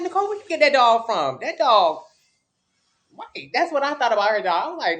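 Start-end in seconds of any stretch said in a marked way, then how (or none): 0.0-2.0 higher, louder mock astonishment; retold amazed reaction. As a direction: exclaim over the dog in a raised voice. Retells reaction to friend's dog.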